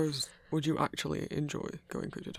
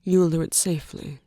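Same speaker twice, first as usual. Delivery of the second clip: louder, stern insistence; firm warning; 11 dB louder than usual. Acted. Guardian warns the cryptid hunter, loud and stern. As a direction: lay down the rule, firmly.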